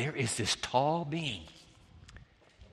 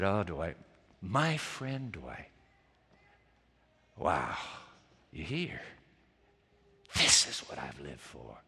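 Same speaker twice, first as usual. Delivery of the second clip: tender greeting; 10 semitones lower, 6 dB quieter, slower than usual. Retold or imitated. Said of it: "he said".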